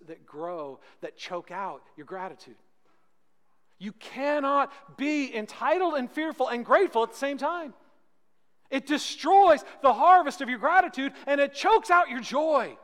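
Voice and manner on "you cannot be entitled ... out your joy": loud, high, driving sermon climax; full-force preaching. Acted. Preacher thunders at the congregation about gratitude, full-force preaching. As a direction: hammer it home to the congregation.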